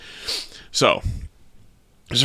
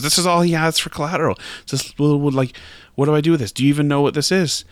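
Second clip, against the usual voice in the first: higher-pitched, exasperated questioning; baffled bank teller; 7 semitones higher, faster than usual. Acted. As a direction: pitch it up, at a loss. Teller asks manager about odd collateral.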